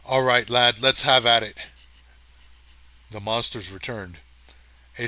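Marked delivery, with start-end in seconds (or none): 0.0-1.6 louder, grumbling agreement with an oath; resigned, gruff go-ahead; grudging curse. A narrator's reading. Marnigan gives in gruffly to Hathaway's plan. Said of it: "Marnigan cursed".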